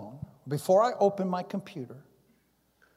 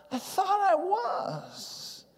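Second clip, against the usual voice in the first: high, drawn-out plaintive protest; small, high, slow protest to God; 13 semitones higher, 3 dB quieter, much slower than usual. Acted. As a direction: stretch it out high, like a wounded objection. Protests to God in retold dialogue.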